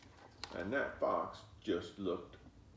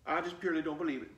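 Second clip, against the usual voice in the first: higher and very fast; little girl voice; 7 semitones higher, 4 dB louder, much faster than usual. Acted. Flossie telling the fox she doesn't believe him, quick. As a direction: rattle it off like a sure little girl.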